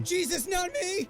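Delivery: high, slow, panicked cry; inner yell. Retold or imitated. Your brain yelling as a large woman comes down the aisle. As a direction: plead with the heavens. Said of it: "Your brain yelling".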